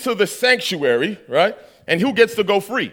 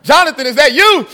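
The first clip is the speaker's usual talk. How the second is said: higher, louder call through a laugh; 6 semitones higher, 6 dB louder than usual. Retold or imitated. Blinded Johnny calling to his friend, laughing. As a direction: call out blindly while cracking up.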